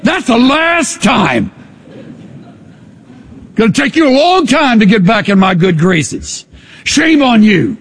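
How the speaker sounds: hammed-up stern rebuke, not meant; mock stern god. Preacher mocking a stern god who scolds, mock stern. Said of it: "he never comes to the place where he says".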